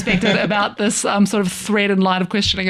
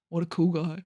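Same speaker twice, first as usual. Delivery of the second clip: quiet, dry compliment; soft deadpan roast; 3 semitones lower, 11 dB quieter than usual. Retold or imitated. New Zealander roasting a try-hard, soft deadpan. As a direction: say it low and flat-nice. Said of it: "someone going".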